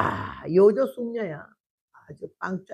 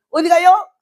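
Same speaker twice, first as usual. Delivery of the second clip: loud question; 7 semitones higher, 9 dB louder than usual. Retold or imitated. Wife asks husband loudly.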